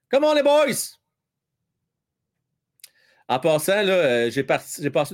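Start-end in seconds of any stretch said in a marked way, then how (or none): 0.1-1.0 cheering. Real, not acted. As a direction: shout encouragement at the game. Cheering on the team.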